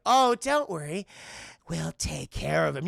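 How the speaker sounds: slow, drawn-out mock reassurance; sly trick-or-treaters. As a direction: promise it with obvious mischief. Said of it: "These trick-or-treaters like".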